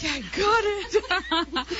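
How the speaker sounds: put-on high laughing kid voice; imitating kid. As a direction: mimic an eager kid, laughing. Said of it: "You're like".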